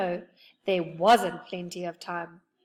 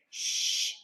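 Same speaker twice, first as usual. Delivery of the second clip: hissed hush; cross whisper; cross whispered shush. A narrator's reading. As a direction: shush them sharply. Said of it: "said Moonface crossly".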